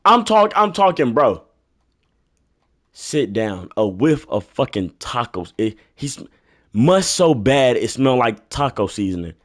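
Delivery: ordinary delivery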